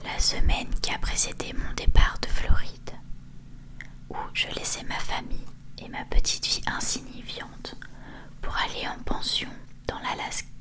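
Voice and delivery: ordinary delivery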